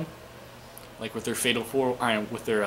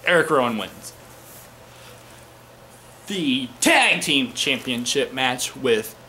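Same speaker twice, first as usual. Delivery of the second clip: normal talking voice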